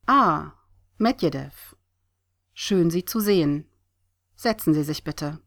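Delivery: lightly acted courteous host; polite greeting. A narrator's reading. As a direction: welcome a visitor with an emphatic "Ah", then settle. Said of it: "begrüßte der Botschafter ihn höflich".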